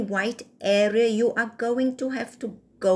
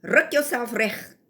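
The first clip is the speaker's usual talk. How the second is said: ordinary delivery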